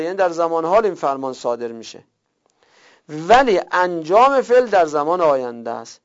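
ordinary delivery

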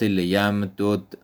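لیم دود split as "liyam-dud", two separate d sounds; لیم دود read unmerged, "li-yam-dud"; Arabic without merging. Shown as a demonstration. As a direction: keep both dals apart in لیم دود. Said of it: "فک ادغام".

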